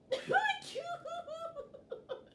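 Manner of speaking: high, squealing burst of laughter; high-pitched laughing squeal; shrieking laugh. Real, not acted. Shrieks with laughter over game.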